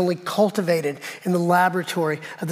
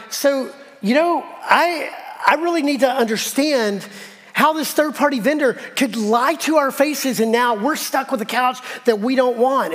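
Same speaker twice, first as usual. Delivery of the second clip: indignant complaint; 5 semitones higher than usual. Retold or imitated. Customer confronts clerk over delivery guy's lie, indignant. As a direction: spell out the grievance with mounting indignation.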